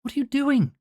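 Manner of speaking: doctor shouting at Kym; doctor's shout. Acted. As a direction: yell in disbelief. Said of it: "the doctor shouts at him".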